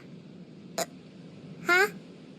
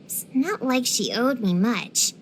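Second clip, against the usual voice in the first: ordinary talk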